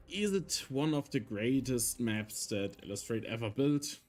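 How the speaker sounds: usual speaking voice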